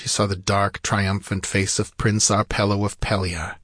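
ordinary delivery

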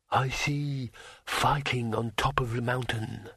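slow, gentle otherworldly dragon; reply gently, drawing the words out. A narrator's reading. Dragon replies to its rider, gentle slow voice. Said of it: "came the gentle reply".

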